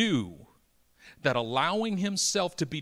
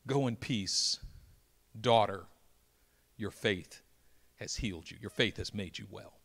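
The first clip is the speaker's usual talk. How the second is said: gentle blessing; 6 semitones lower than usual. Acted. Jesus gently sending the healed woman off, tender.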